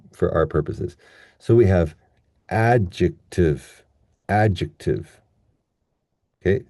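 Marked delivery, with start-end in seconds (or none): 2.5-5.2 "adjective" with a strong AD, weak "jective"; stress on first syllable. Shown as a demonstration. Teacher modelling first-syllable stress, slow, clear "adjective". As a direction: punch AD in "adjective", let the rest fall. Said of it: "stress on the first".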